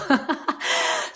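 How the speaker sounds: short breathy chuckle; amused laugh. Real, not acted. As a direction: laugh lightly at your own remark. Laughs after saying horrible.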